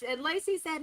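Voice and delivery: normal talking voice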